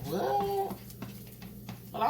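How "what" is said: mock disbelief. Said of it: "and I'm like".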